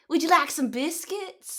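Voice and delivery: put-on slow drawled Southern US accent copy; mock Southern US accent. Friend mimics the Southern US accent she heard. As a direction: imitate her country Southern US accent slowly. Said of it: "you sounded country".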